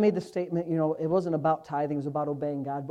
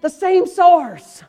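higher, louder, slowing to the punchline; preaching at full force; 11 semitones higher, 13 dB louder than usual. Acted. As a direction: drive the point home from the pulpit.

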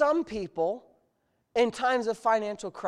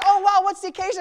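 high, bright mock waitress; 11 semitones higher, 10 dB louder, faster than usual. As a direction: lightly imitate a cheery waitress. Voices the waitress.

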